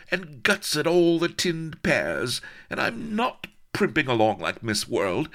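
high, haughty tirade; affronted, high-voiced accusations; indignant scolding. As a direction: tell him off, pitched high. Indignant heroine scolds colonel.

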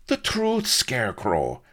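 high, sharp demand dropping at the end; snapping. A narrator's reading. The Colonel shakes the truth from Solomon, snapping. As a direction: snap out an order. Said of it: "snapped the Colonel".

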